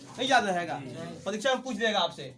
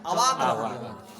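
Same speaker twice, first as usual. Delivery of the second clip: drawn-out, much lower, laughing; stunned punchline; 9 semitones lower, 4 dB louder, slower than usual. Shown as a demonstration. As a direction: act out the stunned man, slowly. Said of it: "ऐसे याद रखना है". (बहुत धीमी, नीची, हँसती आवाज़ में "अवाक")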